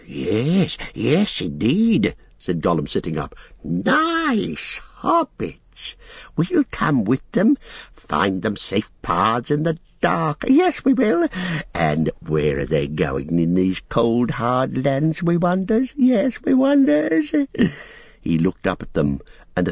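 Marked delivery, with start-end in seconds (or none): 0.1-2.2 lightly voiced eager creature; Gollum, eager. 3.6-17.3 slow creature voice leaping up high; Gollum, fawning and sly. Acted.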